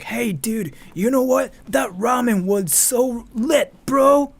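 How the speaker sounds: high, loud surfer-bro drawl; mock bro talk, slow on lit; dude-bro voice. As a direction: ham it up like a stoner bro raving about food. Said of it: "I was not talking like that".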